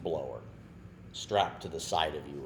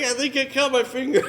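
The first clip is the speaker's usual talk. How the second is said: high, loud and laughing; kid in trouble; 10 dB louder than usual. Retold or imitated. Freshman telling the crew about his finger, high and laughing. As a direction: squeak it out like the kid, barely holding in laughter.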